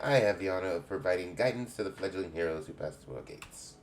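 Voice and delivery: put-on lightly English-accented guildmistress greeting; a hint of a welcoming English matron. Guild head welcomes a young adventurer, light English accent. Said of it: "I'm making everybody have an English accent".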